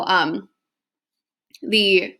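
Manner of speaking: ordinary talk, nothing marked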